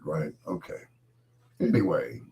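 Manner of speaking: usual speaking voice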